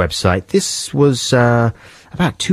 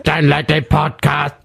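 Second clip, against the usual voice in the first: computer voice; 5 semitones higher than usual. Pretend. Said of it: "and go".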